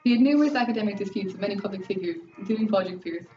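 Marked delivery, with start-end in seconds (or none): none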